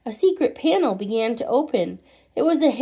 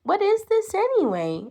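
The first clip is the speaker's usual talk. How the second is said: put-on child's question; 9 semitones higher than usual. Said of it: "asked Tommy".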